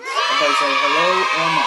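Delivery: loud cheerful call to the class. Real, not acted. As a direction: call out the greeting. Introducing daughter to the audience.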